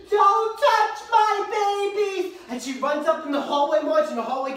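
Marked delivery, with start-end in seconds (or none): 0.1-2.3 high, drawn-out dramatic cry; mock-heroic mother's scream, every word stretched; JoBeth Williams. Imitating a movie mother defending her babies, drawn-out cry. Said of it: "where she's like".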